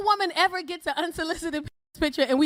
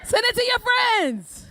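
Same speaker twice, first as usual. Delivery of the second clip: higher, slow drawn-out plea; slow high urging; 8 semitones higher, slower than usual. Acted. Comic urging the men again, slow and high. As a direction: stretch it out, coaxing. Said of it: "repeats the urging".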